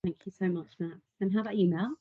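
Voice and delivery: normal talking voice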